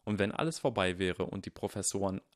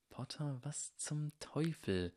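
slow, higher, hushed mutter; whispering; 7 semitones higher, 7 dB quieter, slower than usual. A narrator's reading. Draco secretly questions Harry's plan, whispering. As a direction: whisper without moving the lips. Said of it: "flüsterte Draco, ohne die Lippen zu bewegen".

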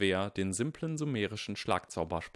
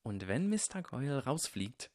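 hushed, higher-pitched urgent hiss; 6 semitones higher than usual. A narrator's reading. Harry presses Draco to act, hissing. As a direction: hiss it under the breath. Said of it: "zischte Harry".

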